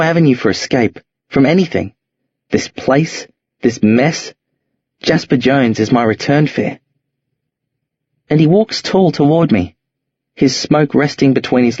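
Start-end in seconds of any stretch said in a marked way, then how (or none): none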